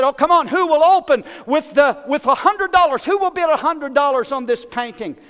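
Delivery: coaxing auctioneer. Acted. Auctioneer urging silent bidders, coaxing and lively. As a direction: auctioneer begging for an opening bid. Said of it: "trying to stir a little enthusiasm".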